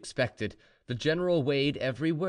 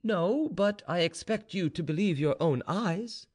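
higher-pitched, insistent informer; lightly voiced confident servant; slave informer; 8 semitones higher than usual. A narrator's reading. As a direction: press your case to a doubting master. Slave answers the doubting general.

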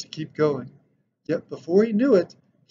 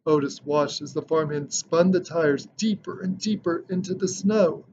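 ordinary delivery, nothing marked

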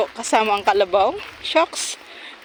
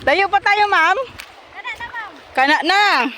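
calling out; 11 semitones higher, 3 dB louder than usual. Real, not acted. Calling out to woman ahead.

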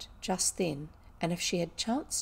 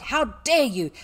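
loud, high-pitched outrage; infuriated; 5 semitones higher, 8 dB louder than usual. A narrator's reading. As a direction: cry out in fury. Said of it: "Miss Minchin was infuriated".

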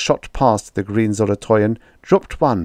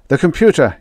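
shouted high and loud; 7 semitones higher, 5 dB louder, faster than usual. A narrator's reading. Holbrook shouting to the others. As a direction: shout the word over the alarm. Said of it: "he shouted".

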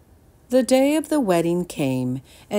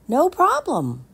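put-on eager boy; 11 semitones higher, 4 dB louder than usual. Jameson answers again.

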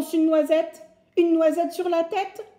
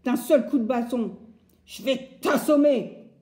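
threatening woodcutter; 4 semitones higher, 9 dB louder than usual. Acted. Bûcheron menace les animaux.

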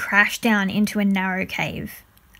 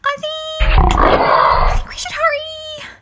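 frightened squeak; 5 dB louder, slower than usual. Acted. Scared boy, monster near.

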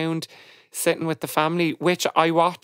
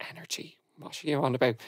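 muttered disbelief; 8 dB quieter, faster than usual. Retold or imitated. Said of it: "they were all like".

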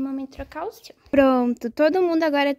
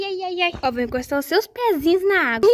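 ordinary talk, nothing marked

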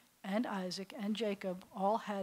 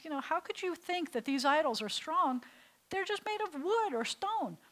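put-on teen Avram; 7 semitones higher than usual. Avram answers strong man.

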